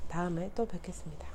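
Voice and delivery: soft whispered goodbye; whispering. Real, not acted. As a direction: breathe the goodbye out, unvoiced. Second sign-off, whispered.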